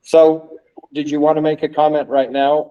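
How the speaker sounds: ordinary talk, nothing marked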